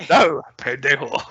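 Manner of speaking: put-on slow, lightly done Scottish accent on a Spanish insult; a hint of Connery's Scottish accent, drawn out; Sean Connery impression. Podcaster quoting the film's villain to co-hosts in a mild Scottish accent. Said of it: "well-spoken Scottish accent".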